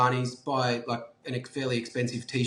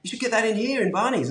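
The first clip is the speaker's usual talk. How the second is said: put-on excited shop kid; 8 semitones higher than usual. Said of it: "And he'd be like".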